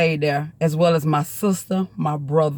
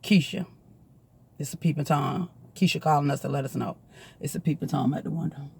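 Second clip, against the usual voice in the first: much softer, lower urgent hush; hushed warning; 6 semitones lower, 9 dB quieter than usual. Retold or imitated. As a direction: warn the house without waking it. Waking household quietly.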